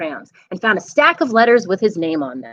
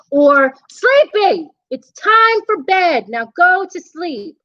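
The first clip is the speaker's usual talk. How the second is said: slow, louder bedtime command; slow firm order; 8 semitones higher, 4 dB louder, slower than usual. Acted. Boy ordering the monster to bed, slow and firm. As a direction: lay down the law, slowly.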